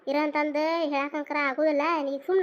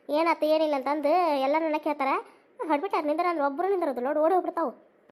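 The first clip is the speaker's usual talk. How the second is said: ordinary talk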